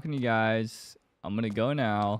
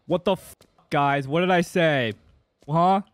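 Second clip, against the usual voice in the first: usual speaking voice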